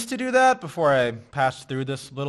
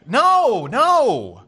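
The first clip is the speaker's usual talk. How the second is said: loud denial; 8 semitones higher, 8 dB louder than usual. Acted. Denies her hooker accusation, loudly.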